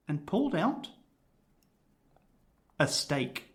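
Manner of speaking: long suspenseful pause before "a stake"; dramatic pause. A narrator's reading. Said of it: "the way that you said it sounds so incredibly dramatic".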